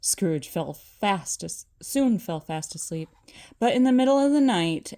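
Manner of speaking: usual speaking voice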